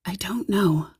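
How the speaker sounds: ordinary delivery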